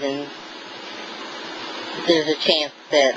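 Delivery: ordinary delivery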